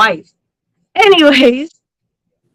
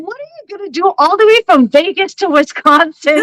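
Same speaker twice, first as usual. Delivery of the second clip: incredulous, pitched up; 7 semitones higher than usual. Retold or imitated. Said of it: "I'm like".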